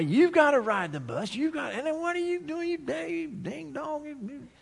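preacher mocking his younger self as a ding dong; scolding inner voice. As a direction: scold yourself in a high, exasperated inner voice. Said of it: "I'm thinking to myself".

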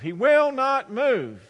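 high, loud and drawn-out WILL NOT MOVE; exasperated wail. Acted. Man at his wits' end over his donkey, wailing exasperation. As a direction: stretch every word at your wits' end. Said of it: "the man is exasperated".

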